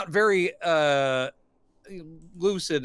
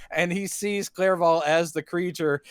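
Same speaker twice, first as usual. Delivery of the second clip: ordinary talk, nothing marked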